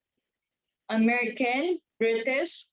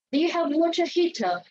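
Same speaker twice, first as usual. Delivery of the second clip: a higher British question with no final r, waw-tuh hee-tuh; British English question; British accent; 7 semitones higher than usual. Shown as a demonstration. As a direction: ask it the British way. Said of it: "or the Brits".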